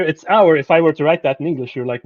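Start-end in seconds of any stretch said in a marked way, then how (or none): 0.0-0.6 its hour pointed out, a little higher and louder; stress on its hour. Shown as a demonstration. A lecturer singling out its hour for students. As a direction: lean on HOUR to show the odd phrase. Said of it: "And here".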